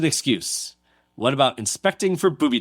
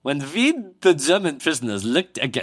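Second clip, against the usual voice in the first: hammed-up German accent; thick mock German with v for w; 3 semitones higher than usual. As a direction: put on an exaggerated comic German accent. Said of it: "How was my German accent".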